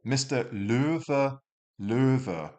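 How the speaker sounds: Löwe slow with a rounded er vowel; ö sound. Shown as a demonstration. Teacher modelling ö in Löwe, slow and high. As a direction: round the lips for Löwe, slowly. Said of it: "O umlaut is".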